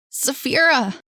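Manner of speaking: high, loud calling of her name. Acted. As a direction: call her name through the house. Boy calls for missing sister.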